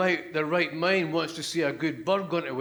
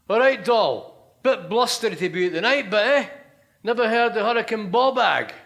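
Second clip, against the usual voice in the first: cabbie greeting; 5 semitones higher, 6 dB louder than usual. Acted. Cabbie greeting a soaked passenger, loud and cheery. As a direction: hail her like a chatty taxi driver.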